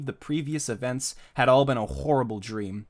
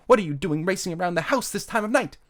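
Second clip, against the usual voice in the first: higher-pitched scolding mom voice; scolding mother; 7 semitones higher, 4 dB louder than usual. Acted. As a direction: scold your son in a raised voice. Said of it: "said his mother".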